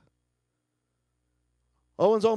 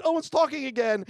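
normal talking voice

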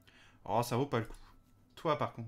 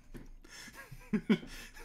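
laugh; 8 semitones higher, 6 dB quieter than usual. Real, not acted. Laughing at his own joke.

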